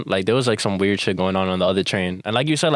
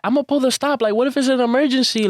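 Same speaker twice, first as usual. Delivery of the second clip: very high-pitched mock alarm; imitating agitated lady; 14 semitones higher than usual. Lady argues for pulling the stop, high agitated mimicry. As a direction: act out a woman insisting, voice pitched way up.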